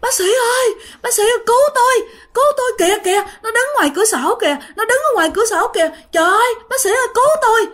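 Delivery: very high, frantic screams; screaming for help. Acted. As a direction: shriek to the doctor for rescue in terror. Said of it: "bả cứ la làng".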